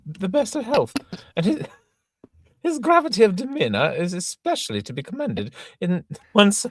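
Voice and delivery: very high, loud, prim governess voice; Miss Prism. Acted. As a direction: scold her pupil in a shrill falsetto. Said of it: "Miss Prism".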